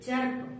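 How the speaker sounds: चर्प with the short uh sound, "churp"; चर्प said with a schwa, not an ee; correct vowel. Shown as a demonstration. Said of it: "इसको चिर्प नहीं पढ़ेंगे".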